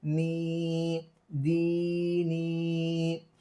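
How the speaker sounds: नी and दी each held long and steady; drawn-out long ee. Shown as a demonstration. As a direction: stretch दी नी evenly. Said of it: "इसको थोड़ा खींच कर पढ़ें". (दी नी को खींच कर पढ़ना)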